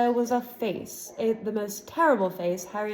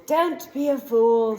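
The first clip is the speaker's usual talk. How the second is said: slow, louder snarl; villain warning the fleeing boy; 4 semitones higher, 6 dB louder, slower than usual. A narrator's reading. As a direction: bite the words like a threat. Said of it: "snarled the face".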